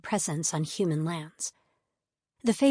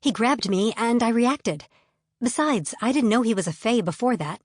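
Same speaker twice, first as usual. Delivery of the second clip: defensive; 5 semitones higher, 4 dB louder than usual. Acted. Heroine keeps defending herself.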